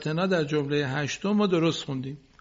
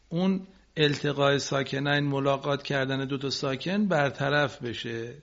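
normal talking voice

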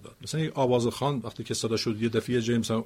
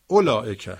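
اولائکه with the opening او raised and louder; stress on first syllable; 7 dB louder than usual. Shown as a demonstration. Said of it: "کدومش رو بالاتر ببرید". (اولائکه با تکیه روی بخش اول)

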